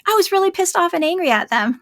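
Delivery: mock-guilty parent owning up; imitating a parent. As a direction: play the parent admitting it. Retelling a parent's confession.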